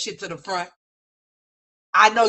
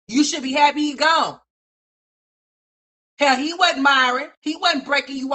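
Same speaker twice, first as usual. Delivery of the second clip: higher and louder, shouted at her; shouting; 5 semitones higher, 4 dB louder than usual. Real, not acted. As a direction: yell it at her for emphasis. Repeats line as a shout.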